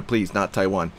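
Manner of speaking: normal talking voice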